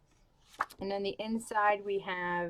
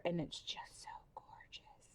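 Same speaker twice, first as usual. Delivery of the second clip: whisper; 2 semitones lower, 15 dB quieter than usual. Real, not acted. Admiring book cover aloud.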